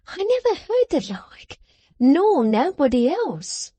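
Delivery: high, slow simpering maid; high drawn-out simpering servant girl; Miggs simpering. A narrator's reading. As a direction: coo his name like a fawning maid. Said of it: "pursued Miggs".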